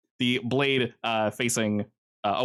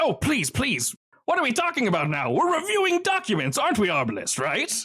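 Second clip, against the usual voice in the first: much higher, sing-song villain; high sing-song smug crime boss; Kincaid's mock innocence; 12 semitones higher than usual. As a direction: feign innocence like a smug sadist. Kincaid mocking.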